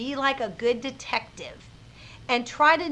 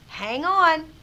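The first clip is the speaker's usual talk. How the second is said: hold on; 5 semitones higher, 5 dB louder than usual. Shown as a demonstration. Voicing what an ellipsis tells.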